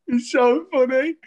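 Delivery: real high-pitched squeal of amusement; helpless amusement. Real, not acted. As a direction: barely get it out for laughing. Cracking up at retold caller.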